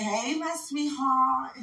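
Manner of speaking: high, soft, slowly drawn-out greeting; sweet-talking. Real, not acted. On here to greet a sweetheart, soft high cooing. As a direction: coo it softly and stretch it out.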